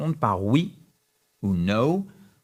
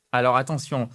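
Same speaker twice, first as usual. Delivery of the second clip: ordinary talk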